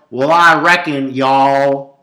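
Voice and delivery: loud, drawn-out mock Texas twang; big hokey Texas country-boy voice; hillbilly drawl. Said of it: "people that go".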